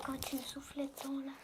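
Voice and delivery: murmure tout près du micro; chuchotement ASMR. Acted. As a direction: chuchoter à l'oreille. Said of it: "Ça fait de l'A S M R". (chuchotement à l'oreille)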